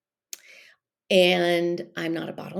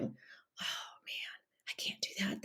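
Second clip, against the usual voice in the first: soft breathy whisper of self-doubt; inner voice whisper; 17 dB quieter, slower than usual. Retold or imitated. Inner voice of guilt talking you out of self-care, whispered. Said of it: "that little voice going".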